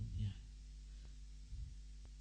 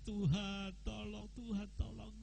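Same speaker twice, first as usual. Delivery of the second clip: mock weeping plea; 5 semitones higher, 14 dB quieter than usual. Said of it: "saudara jangan nangis".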